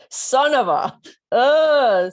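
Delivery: hammed-up angry yelp; pained outburst. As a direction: blurt it out after hitting your head. Bumped head in shower.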